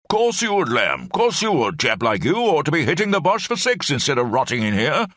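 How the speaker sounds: put-on high, hearty old officer rambling on; blustering old brigadier. Old brigadier rambles to Lamb in the cell, high blustering voice.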